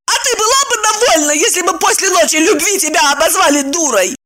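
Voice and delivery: high, fast indignant outburst; furious retort. Acted. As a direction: snap back in fury. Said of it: "вызверилась Ева".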